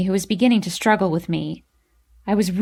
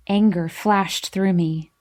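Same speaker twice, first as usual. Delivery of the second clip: ordinary delivery, nothing marked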